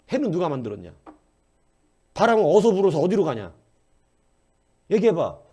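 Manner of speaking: God challenging Job. Retold or imitated. Preacher voices God confronting Job, loud and demanding. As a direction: boom out questions Job cannot answer. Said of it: "하나님이 와갖고".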